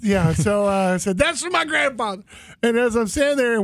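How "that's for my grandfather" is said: higher-pitched proud proclamation; raised, declaiming quote; retold declaration. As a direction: announce it to the sea.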